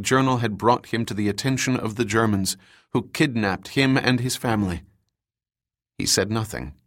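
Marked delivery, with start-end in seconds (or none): none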